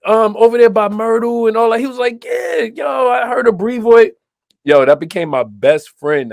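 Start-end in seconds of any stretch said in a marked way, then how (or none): 0.1-4.1 high, animated back-and-forth; retold excitement. Two kids abroad finding shared home turf, excited high-pitched retelling. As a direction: replay the thrill of recognition, pitched well up.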